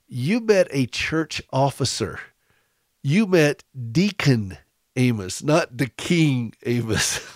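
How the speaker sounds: slow, deliberate retold explanation; slow correction. Amused pastor corrects the visitor's mistake, slowly and deliberately. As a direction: spell it out slowly, phrase by phrase.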